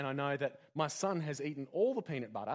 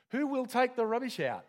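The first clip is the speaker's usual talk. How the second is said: higher, pointed nagging question; nagging spouse; 8 semitones higher, 5 dB louder than usual. Acted. Spouse nagging partner about the rubbish: pointed, higher nag. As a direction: needle your partner with it. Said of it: "nags you with questions".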